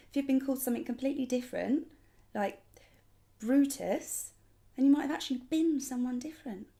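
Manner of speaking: worked-up, emphatic enthusiasm; carried away. Acted. Excited pitch about names.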